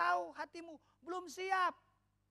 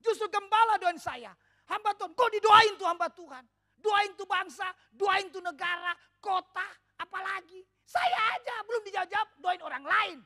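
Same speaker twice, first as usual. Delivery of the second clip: louder, higher indignant retort; mock indignant; 5 semitones higher, 9 dB louder than usual. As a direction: huff it like an offended churchgoer. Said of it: "seringkali kita berkata".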